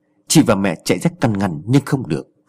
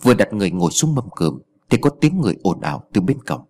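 usual speaking voice

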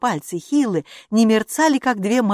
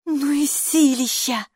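high, slow marvelling; admiring exclamation in a raised voice; respectful awe; 9 semitones higher, slower than usual. A narrator's reading. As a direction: draw it out, impressed. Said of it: "уважительно проговорила Хилла".

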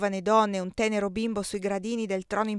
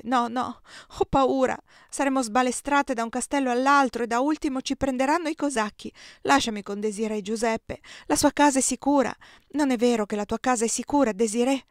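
raised, louder, fearful pleading through tears; frightened pleading; 5 semitones higher, 6 dB louder than usual. Acted. Giulia pleads with Giuseppe, frightened and tearful. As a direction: beg not to be taken away, scared.

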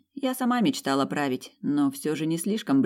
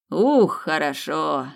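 slow, drawn-out sigh of satisfaction; sated grunting; 5 dB louder, much slower than usual. Acted. Phoenix soaks up rebirth energy, sated drawn-out grunt. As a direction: savour it, stretching each word. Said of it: "раздалось сытое покрякивание Феникса".

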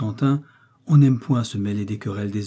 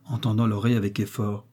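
ordinary delivery

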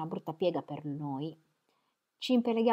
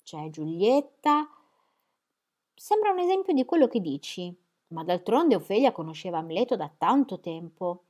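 playful; 5 semitones higher, 8 dB louder than usual. A narrator's reading. Mary listing lovers, rising animatedly.

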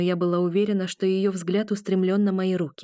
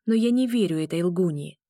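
ordinary delivery

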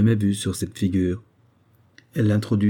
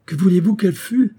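high, loud indignant question; 10 semitones higher, 5 dB louder than usual. A narrator's reading. Edward answers doubt about his marriage, indignantly. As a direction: throw the doubt back at the listener.

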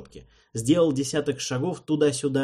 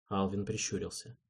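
plain reading voice

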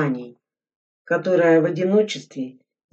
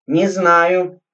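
angry admission; 3 semitones higher, 7 dB louder than usual. Acted. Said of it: "сердито признался Игорь".

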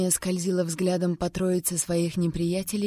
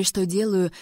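plain reading voice

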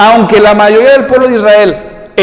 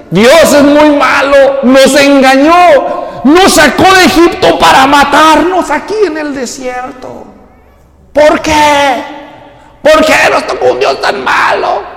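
mocking complainers; 6 semitones higher than usual. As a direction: parody the ungrateful grumblers. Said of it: "Ahora estos están diciendo". (israelitas quejumbrosos, voz aguda)

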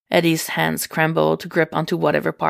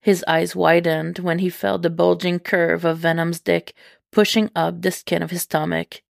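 ordinary delivery

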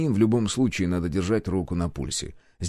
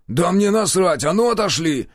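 raised, higher aggressive voice; angry order; 9 semitones higher, 5 dB louder than usual. A narrator's reading. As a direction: order them off angrily. Hunter threatens them with a gun. (злой окрик с угрозой)

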